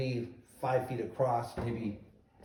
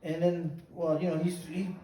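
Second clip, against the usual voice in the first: ordinary talk, nothing marked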